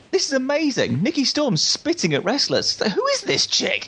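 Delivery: high-pitched, drawn-out amazement; amazed. Retold or imitated. Fan recalling a spitting wrestler, amazed. Retelling his amazed first reaction.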